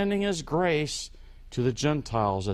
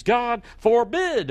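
louder, higher, drawn out slowly; emphatic God forbid; 7 semitones higher, 5 dB louder, slower than usual. Retold or imitated. Preacher giving the King James refusal, loud and slow. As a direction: proclaim it like an outraged denial. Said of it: "the most emphatic expression of no".

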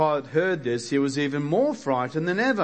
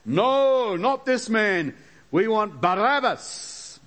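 crowd shout; 8 semitones higher, slower than usual. Acted. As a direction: hurl it back like an angry mob. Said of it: "they shouted back".

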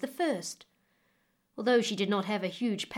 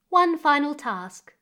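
louder, higher and slow, weighty; commanding queen; 5 semitones higher, 7 dB louder than usual. Acted. Queen setting May's final task, loud, high and slow. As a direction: announce it slowly like a queen's decree.

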